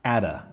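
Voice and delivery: "At a" joined as atta; linked like one word. Shown as a demonstration. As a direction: say "At a" as a single word, "a" as uh. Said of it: "become like one word".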